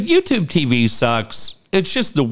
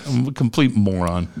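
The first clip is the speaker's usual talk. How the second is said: ordinary talk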